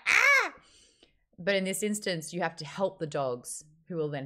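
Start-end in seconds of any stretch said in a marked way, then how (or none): 0.1-0.5 short high mock scream; loud high-pitched "Ah!"; imitated scream. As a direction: shriek like an outraged character, played for laughs. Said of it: "she's just like".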